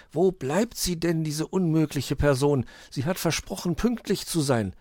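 lightly voiced impatient grumble; a hint of a grumbling uncle muttering to himself. Weißohr quotes his impatient uncle, mildly grumbling. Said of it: "laut mit sich selbst sprechen".

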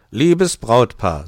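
slow, loud, booming greeting; Santa's address. Acted. Santa greeting the bridal couple, slow and loud. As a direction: open the toast grandly and slowly.

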